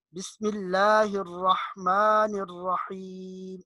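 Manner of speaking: very slow chant, pharyngeal ح in الرحمن الرحیم; correct deep ha. Shown as a demonstration. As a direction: draw out الرحمن الرحیم with ha from the throat. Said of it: "تو ہا کی آواز آنی چاہیے". (الرحمن الرحیم کھینچ کر، حلق والی حا)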